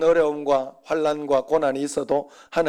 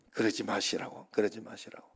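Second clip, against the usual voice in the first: hushed, imploring plea to stop; retelling a quiet plea to two angry men; soft pleading; 7 semitones lower, 10 dB quieter than usual. Retold or imitated. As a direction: gently beg them to calm down. Pastor begging quarrelling men to stop.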